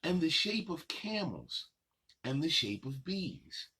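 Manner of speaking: lilting rhyme reading, slowing on the last line; reading to children. Acted. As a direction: read the list of shapes to little kids, savoring it. Reads picture book aloud.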